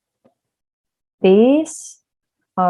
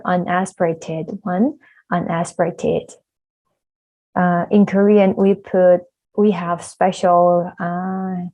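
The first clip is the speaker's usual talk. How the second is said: ordinary talk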